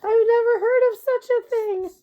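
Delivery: high, loud laughing voice; laughing rhino. A narrator's reading. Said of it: "He roared with laughter".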